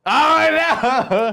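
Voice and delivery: high, loud shout of dismay; shocked cry. Real, not acted. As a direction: yelp it out at the bad roll. Dice roll goes badly.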